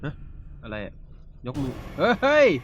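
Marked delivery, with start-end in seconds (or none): 2.2-2.5 high, loud urgent cry to run; panicked shout. Real, not acted. Gamer fleeing a boss attack, panicked shout. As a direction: yell at yourself to flee.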